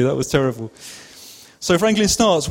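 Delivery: ordinary talk, nothing marked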